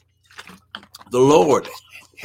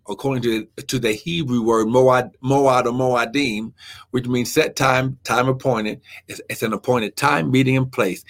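ordinary delivery

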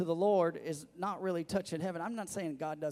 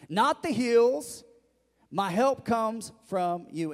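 loud, raised, emphatic scripture proclamation; preaching at full force; 5 semitones higher, 8 dB louder than usual. Acted. Pastor proclaiming David's psalm on where help comes from, forceful and loud. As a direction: drive the psalm home to the congregation, loudly.

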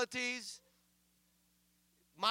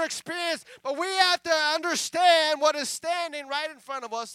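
loud, high, full-force exhortation; fervent raised sermon voice; preaching; 5 semitones higher, 12 dB louder than usual. Real, not acted. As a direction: preach at full voice, hammering each phrase.

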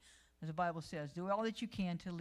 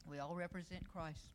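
normal talking voice

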